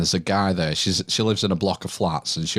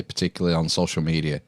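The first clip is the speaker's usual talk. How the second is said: ordinary talk, nothing marked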